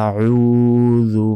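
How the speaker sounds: আউযু with the first vowel drawn one count; recited আউযু with elongation; one alif stretch. Shown as a demonstration. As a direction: lengthen the vowel in আউযু slowly. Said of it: "এক আলিফ টেনে পড়তে হবে".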